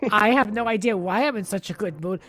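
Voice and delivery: ordinary talk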